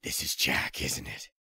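Snake's voice. Acted. Old soldier explains his pursuit to Drebin, low and weary. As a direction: drop into the tired veteran's low voice.

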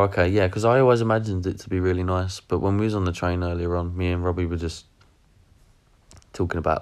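ordinary talk

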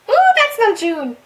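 excited recognition. Real, not acted. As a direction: squeal his name in delight. Fan spots member.

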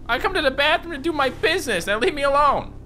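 high-pitched indignant outburst; mock indignation. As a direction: protest in a comically high voice.

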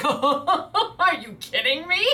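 high, laughing mock disbelief; thrilled disbelief. Fan retelling her reaction to a finale invite, high laughing delight. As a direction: squeal it like the best invite ever. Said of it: "I'm like".

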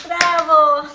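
loud burst of praise; cheering bravo. Real, not acted. Parent applauding Jeron's success, loud cheering. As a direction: cheer loudly for a little one.

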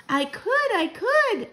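put-on very high, eager child's voice; excited cry. A narrator's reading. Mouse grandchild agreeing to spy, excited cry. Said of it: "I cried".

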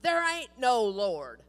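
loud, slow retort; angry atheist shout. Retold or imitated. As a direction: shout it back at the neighbor. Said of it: "he would shout".

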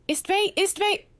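high, urgent cry of relief; choked cry. A narrator's reading. Relieved man calls to waking friend, choked cry. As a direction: call the name twice, pitched high, choked with relief. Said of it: "His voice choked again".

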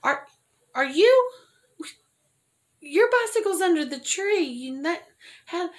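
excited coworker. Retold or imitated. Coworker rushing to tell her the old bike is back, excited. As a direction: blurt it out, high and thrilled. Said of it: "my coworker goes".

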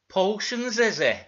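loud, stern character question; Zebedee. Acted. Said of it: "said Zebedee".

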